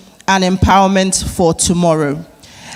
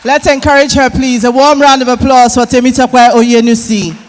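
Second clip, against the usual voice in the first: louder, higher emcee hype; rally the crowd to cheer; hyping applause; 4 semitones higher, 6 dB louder than usual. Real, not acted. Host calls for audience applause.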